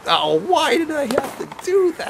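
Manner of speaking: high, slow whiny regret; mock self-reproach. After embarrassing himself with Reeves, high whiny regret. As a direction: whine at yourself for the blunder.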